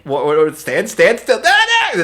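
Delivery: panicked mock yelping, high and loud; imitated mother's scream. Mother yelping at her unconscious child, retold mock panic. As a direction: imitate a frantic yelp, rising in pitch. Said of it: "my mother's scream".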